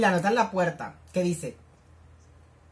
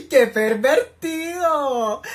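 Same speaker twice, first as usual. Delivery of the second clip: very slow, drawn-out, louder mock shock; mock scandalized; 4 semitones higher, 6 dB louder, much slower than usual. Real, not acted. Viewer teasing the character for staring, drawn-out mock outrage. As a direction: stretch it out, playfully scandalized.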